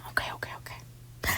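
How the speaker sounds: hushed unvoiced okay okay; whispered okays. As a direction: whisper it quickly. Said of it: "He's like".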